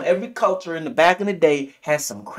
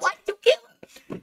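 much higher, disbelieving cry; shocked question; much faster than usual. Retold or imitated. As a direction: squeak it out in horror, way up high. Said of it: "He's like".

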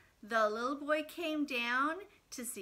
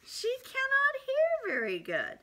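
animated; 12 semitones higher, 4 dB louder than usual. Acted. Playful storytelling to kids.

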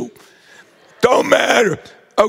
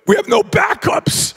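coach deadpan; 11 semitones higher than usual. Retold or imitated. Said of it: "He goes".

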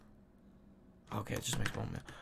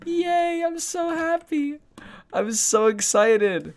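cooing; 9 semitones higher, slower than usual. Acted. Cooing over tree.

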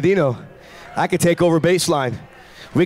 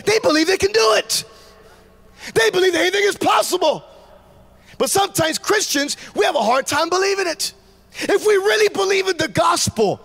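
rising preacher shout; 11 semitones higher than usual. Acted. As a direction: lift the crowd with it. Preaching at full force.

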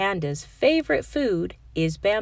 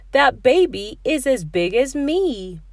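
amazed; 6 semitones higher, 5 dB louder than usual. Acted. Pig amazed at baby hippo.

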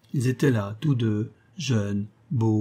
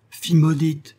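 loud, raised-pitch curse; angry cry; 6 semitones higher, 7 dB louder than usual. A narrator's reading. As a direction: hurl it out in anger. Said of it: "s'écria-t-il avec colère".

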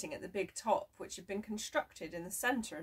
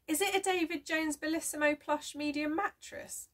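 hopeful question; 9 semitones higher, 5 dB louder than usual. A narrator's reading. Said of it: "he asked hopefully".

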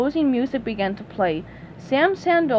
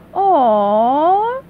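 high, loud crowd aww; touched aww; 4 semitones higher, 6 dB louder than usual. Acted. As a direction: coo like a moved audience. Audience reacts.

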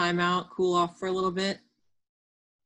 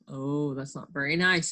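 ordinary delivery

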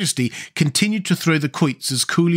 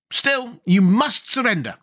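high, fierce insistence; 9 semitones higher, slower than usual. A narrator's reading. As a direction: insist fiercely in a raised pitch. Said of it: "exclaimed the general fiercely".

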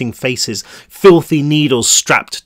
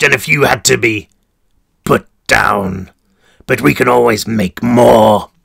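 gleeful warden; 3 dB louder than usual. Acted. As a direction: relish every word with glee. Warden gloats.

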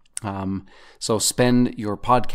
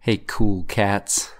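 slow, smooth late-night radio host; slower than usual. Pretend. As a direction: croon it like an old-school DJ. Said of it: "I always did want a career in radio".

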